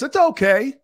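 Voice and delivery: high, lightly voiced farmer, unbothered; lazy farmer. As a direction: shrug it off in a lightly played farmer voice. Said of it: "And he says".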